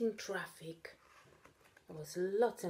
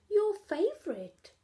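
high, louder tempting call; enticing; 13 semitones higher, 7 dB louder than usual. Acted. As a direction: tempt him with it. Katy tempting Charlie.

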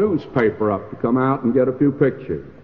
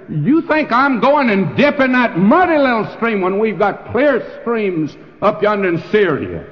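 raging general; 6 semitones higher than usual. Acted. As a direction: scoff at the muddy river in a rage. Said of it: "went away in a rage".

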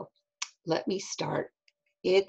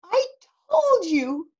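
cried out; 12 semitones higher, 4 dB louder than usual. Acted. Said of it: "cried Eddie".

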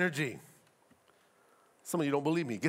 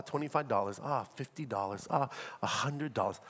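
low, offhand mumbled giving; casual; 7 semitones lower, 5 dB quieter than usual. Acted. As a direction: toss it off casually, softer and lower. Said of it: "Are we casual?".

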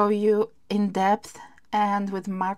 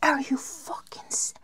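stunned whisper; 3 semitones higher, 7 dB quieter than usual. Real, not acted. Stunned at the gift.

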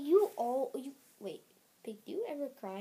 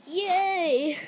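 loud, higher cheer into mock alarm; big cartoon yay, then oh no; hammed-up excitement; 7 semitones higher, 17 dB louder, much slower than usual.

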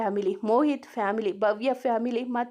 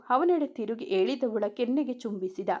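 ordinary delivery, nothing marked

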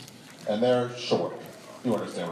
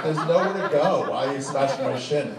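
mock complaint; 4 semitones higher, 4 dB louder than usual.

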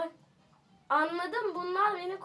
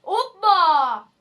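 high, loud jeering questions; mocking taunt; 9 semitones higher, 12 dB louder, slower than usual. Real, not acted. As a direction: taunt a crying loser, pretending not to hear. Mocking a game opponent.

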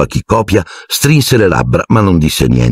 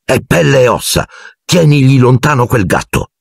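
higher-pitched angry demand; infuriated; 6 semitones higher than usual. A narrator's reading. Ron dangling the rat before Hermione, infuriated. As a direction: scold heatedly at a raised pitch. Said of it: "disse infuriato a Hermione".

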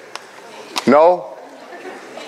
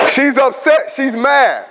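laughing; 7 semitones higher, slower than usual. Real, not acted. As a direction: still laughing through the words. Laughing while continuing story.

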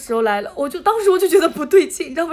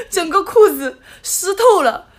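laughing it out slowly; drawn out through laughter; laughing, slowed; 6 semitones higher, 3 dB louder, slower than usual. Real, not acted. Laughing about soaked trousers.

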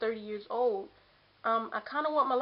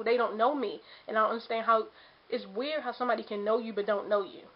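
ordinary talk

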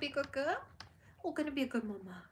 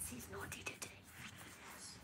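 unvoiced, soft whisper; hushed whispered aside; 19 dB quieter than usual. Real, not acted. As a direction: breathe it like a secret. Whispered repeat.

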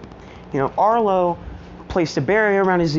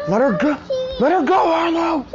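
yelling; 12 semitones higher than usual. Retold or imitated. Retelling the fight, Izuku yells at Arlo to let her go, rising. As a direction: shout it, rising as he repeats it. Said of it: "Izuku yells".

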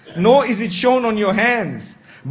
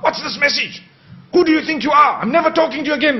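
higher, sharp confronting tone; accusing outburst; 6 semitones higher, faster than usual. Shown as a demonstration. Wife confronting her husband about a message, angrily. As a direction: hit him with the accusation. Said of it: "But if you come up".

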